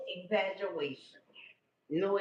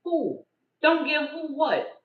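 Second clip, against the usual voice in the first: mock outrage, higher and louder; indignant retort; 5 semitones higher, 6 dB louder than usual. Grandma to her grown daughter, mock indignant and loud. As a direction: play it up as a grandma who can't believe what she heard. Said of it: "I say".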